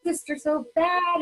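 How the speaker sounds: put-on high, pleading child voice, lightly done; little girl begging. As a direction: mimic a five-year-old begging her parents. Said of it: "And she'd be like".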